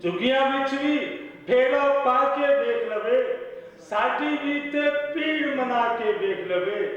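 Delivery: measured mushaira delivery; ghazal recital. Acted. Poet opening a ghazal for the audience, declaimed recital. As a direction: declaim the opening verse, pausing between phrases.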